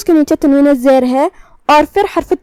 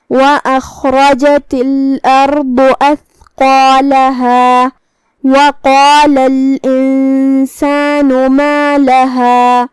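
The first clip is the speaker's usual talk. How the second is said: very slow tajweed with heavy letters; Quran recitation; much slower than usual. Shown as a demonstration. Teacher reciting verses after explaining tafkheem, slow Quran recitation. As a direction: recite steadily, stretching the long vowels. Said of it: "دونوں کو تفخیم سے ادا کریں گے".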